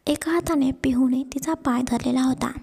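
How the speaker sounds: ordinary delivery, nothing marked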